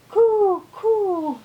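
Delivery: high, long coos; bird cooing. Acted. Teacher showing many bird sounds, high cooing. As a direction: coo loudly like a bird. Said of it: "So many bird sounds".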